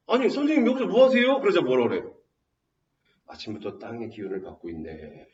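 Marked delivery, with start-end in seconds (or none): 0.1-1.4 high-pitched mock surprise; puzzled passing farmer. Passing farmer couple ask the scholar, puzzled. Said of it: "마침 지나가던 농부 부부가". 3.3-5.3 low, soft feigned composure; two-faced scholar. Acted. Caught scholar answers farmer couple, feigning composure. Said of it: "겉가속이 이렇게 다른 거죠".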